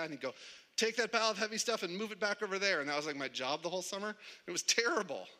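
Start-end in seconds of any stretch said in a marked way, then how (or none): 0.8-2.8 high, fast imitation of a foreman; bossy order. Boss undoing yesterday's work, quick bossy order. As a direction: rattle off a mock order, pitched up.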